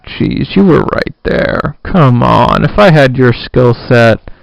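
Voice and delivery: loud exasperation at a player; exasperated fan outburst. Real, not acted. Fan yelling at a basketball player, loud exasperation.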